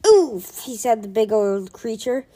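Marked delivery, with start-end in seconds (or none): 0.1-0.6 oof of pain. Acted. Creature gets punched.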